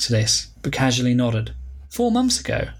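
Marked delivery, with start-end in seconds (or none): 1.9-2.7 surprised thought. Acted. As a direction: pitch jumps up in disbelief. Nicole's inner surprise.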